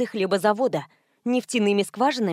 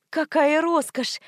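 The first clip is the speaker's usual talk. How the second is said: drawn-out, higher-pitched exclamation; involuntary admiration; 7 semitones higher, slower than usual. A narrator's reading. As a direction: let it slip out in slow admiration. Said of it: "вырвалось у меня".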